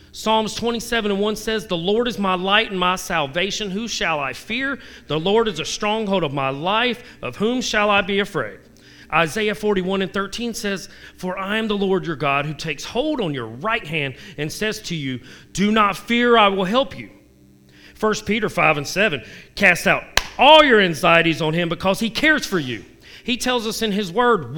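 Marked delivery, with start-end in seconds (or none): 1.7-8.6 raised, earnest Bible reading; preacher reading a psalm; scripture reading. Acted. 11.2-17.1 raised, earnest Bible reading; scripture reading. Acted. 19.6-22.8 raised, earnest Bible reading; preacher reading a psalm; scripture reading. Acted.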